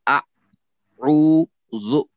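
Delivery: "आ, उ, जु" one sound at a time, long gaps between; broken into syllables. Shown as a demonstration. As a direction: say आ... उ... जु, each sound held apart. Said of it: "उस अल्फाज को तोड़ दीजिए". (आ, उ, जु को तोड़कर, अलग-अलग बोलें)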